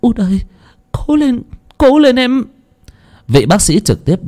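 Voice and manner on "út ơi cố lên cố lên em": crying out. Acted. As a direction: cry out to the son being wheeled by. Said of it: "gào khóc".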